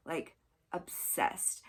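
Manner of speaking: slow, breathy whisper of a confession; hushed emphasis. Real, not acted. Confessing to viewers she loves cults, slow whisper. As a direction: drop to a whisper and stretch it out.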